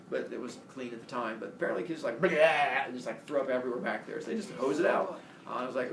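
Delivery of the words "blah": imitated retch, "blah"; mock vomiting noise. Drunk kids in the police back seat, mock vomiting. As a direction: heave it out like throwing up.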